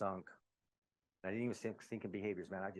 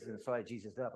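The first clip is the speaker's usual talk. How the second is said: ordinary delivery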